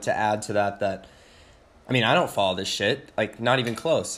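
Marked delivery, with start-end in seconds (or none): none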